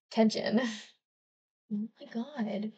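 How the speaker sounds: plain reading voice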